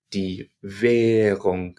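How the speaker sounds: "Währung" slowly with a long held "ä"; long German E. Shown as a demonstration. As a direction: stretch the "ä" in "Währung" nice and long. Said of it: "And it's nice and long".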